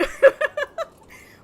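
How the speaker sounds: high burst of laughter; loud laugh. Real, not acted. Laughing at own inconsistency.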